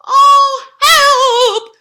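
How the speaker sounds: high loud frightened cry; shivering. Acted. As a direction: squeal for help. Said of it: "shivered Horace".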